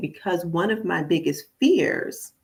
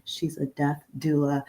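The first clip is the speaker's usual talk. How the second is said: softer, close to the microphone; quietly into the mic; 5 dB quieter than usual. Shown as a demonstration. Host saying a touchy title to listeners, hushed. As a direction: tuck the words into the mic. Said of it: "I'm gonna say it into my microphone".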